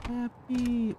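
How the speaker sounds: high sing-song call. Acted. Calls the unit sing-song.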